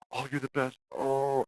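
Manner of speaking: high, lightly gushing fake praise; a hint of buttering someone up; fake flattery. Shown as a demonstration. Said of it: "disingenuous praise".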